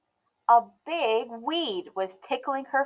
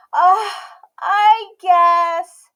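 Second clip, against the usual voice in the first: sighed; 10 semitones higher, 15 dB louder, much slower than usual. A narrator's reading. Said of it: "sighed Emmy".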